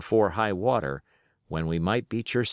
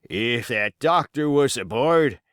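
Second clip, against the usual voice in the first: barked; 4 semitones higher, 4 dB louder than usual. Acted. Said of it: "he barked".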